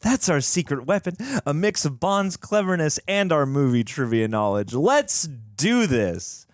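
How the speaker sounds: high, eager character read; hammed-up enthusiastic heroine; excited. Reading Adelia's scripted dialogue.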